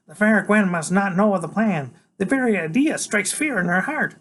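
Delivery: put-on high, grand secret-keeping boy; Freak's voice. A narrator's reading. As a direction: announce the plot like a knight.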